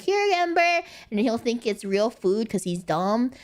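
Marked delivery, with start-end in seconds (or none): 0.0-0.8 coaxing pet. Shown as a demonstration. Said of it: "be like".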